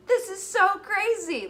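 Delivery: high, loud, slow exclamation; amazed exclamation. Real, not acted. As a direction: draw it out, loud and high in disbelief. Exclaims over her pregnancy mood swings.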